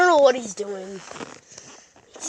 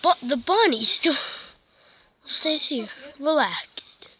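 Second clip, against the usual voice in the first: solemn wildlife narrator voice; nature documentary. Acted. Kid narrates cats as documentary.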